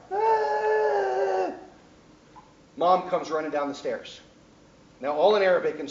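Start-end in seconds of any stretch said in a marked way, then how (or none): 0.1-1.5 put-on sudden high wailing cry of a hurt boy; loud pained child's cry. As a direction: let out a short, shrill kid's yelp. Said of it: "The boy starts crying".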